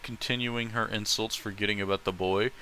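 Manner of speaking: ordinary talk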